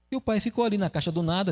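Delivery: ordinary talk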